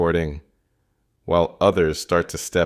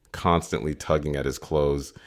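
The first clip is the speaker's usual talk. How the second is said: ordinary delivery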